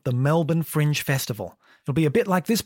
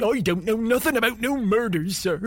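higher, surly country yokel; rustic groundskeeper; 5 semitones higher than usual. Acted. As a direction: grumble like a suspicious gardener. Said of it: "Groundskeeper Charlie".

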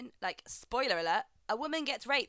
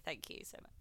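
ordinary talk

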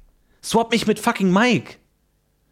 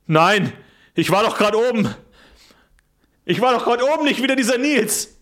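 panicking, pitch climbing higher; mock outrage; 5 semitones higher than usual. Reborn soul protesting to Nils, pitch climbing. Werner upset to be back.

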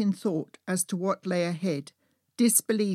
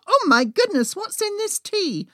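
high, loud splutter of shock; 12 semitones higher, 5 dB louder than usual. Acted. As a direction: exclaim it high, choking on spiked tea. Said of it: "I spluttered as the spiked hot tea hit".

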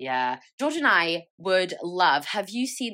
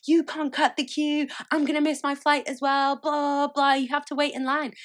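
mild mock yell; 8 semitones higher, 4 dB louder than usual. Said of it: "this guy starts shouting at me".

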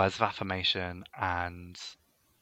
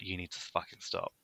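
soft, low deadpan; deadpan put-down; 5 semitones lower, 15 dB quieter than usual. Retold or imitated. Bully telling a clingy friend to stop, quiet deadpan. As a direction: say it flat and quietly cutting. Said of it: "there comes a time where I'm like".

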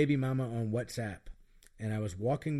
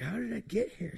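confused high voice; 11 semitones higher than usual. Retold or imitated. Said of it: "I was like".

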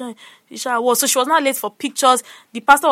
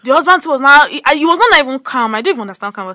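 normal talking voice

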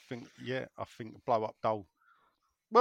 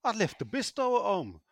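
higher-pitched comic Yorkshire housewife; put-on Yorkshire wife voice, higher; 7 semitones higher than usual. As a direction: call to your husband as a Yorkshire wife in a joke. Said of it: "the wife comes out and says to her husband".

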